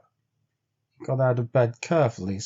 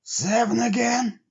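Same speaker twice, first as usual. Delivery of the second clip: much higher, doubting Henry; wary question; 11 semitones higher than usual. A narrator's reading. Henry asking Bill if there are seven again, wary. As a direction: ask warily, bracing for bad news. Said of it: "Henry queried".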